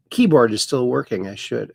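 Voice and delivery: ordinary delivery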